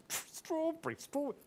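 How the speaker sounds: "Strawberry" squeezed to two syllables, very high; mimicked clipped strawberry. Classmates laughing at his word, mimicked "Strawberry" as "straw-bree". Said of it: "people would laugh".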